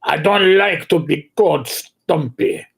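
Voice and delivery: put-on touchy Russian-accented objection; Russian dwarf. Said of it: "played a Russian dwarf".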